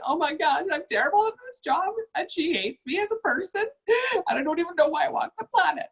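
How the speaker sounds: high, hammed-up whiny wail, fast at the end; mock despair, rushed; mock self-pity. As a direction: pretend to cry over a no. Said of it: "go to the back and cry and say".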